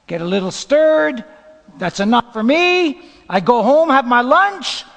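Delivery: loud caricature of easy complacency; complacent churchgoer. Acted. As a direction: lightly send up a self-satisfied believer.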